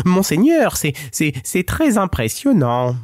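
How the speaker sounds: high, stammering mock terror; faked panic. Cat pretends fear before the lion, high stammering voice. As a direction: stutter as if the lion scares you. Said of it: "simulant une peur panique".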